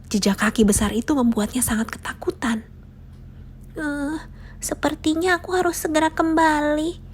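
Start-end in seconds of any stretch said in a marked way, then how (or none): 3.8-7.0 higher, small hesitant mouse voice; a little mouse deciding to back out; nervous mouse thought. A narrator's reading. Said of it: "pikir si tikus". (tikus kecil yang mulai gugup)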